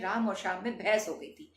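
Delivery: fast, words run together so "में भैंस" slurs as one; said in a rush. Retold or imitated. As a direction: rattle it off hurriedly, swallowing words. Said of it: "आप इतनी जल्दी में होते हैं बोलते वक्त". (जल्दी-जल्दी, शब्द मिलाकर बोला गया)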